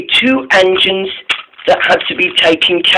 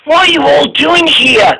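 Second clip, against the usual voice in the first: Caitlin's voice; 12 semitones higher than usual. A narrator's reading. Caitlin voiced high in story.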